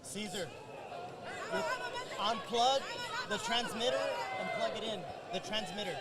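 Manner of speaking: hushed aside. Real, not acted. Off-air aside to crew.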